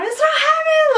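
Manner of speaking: high, loud squeal; imitated frantic disbelief. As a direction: squeal it like a stunned sister. Sister's frantic news to mom retold.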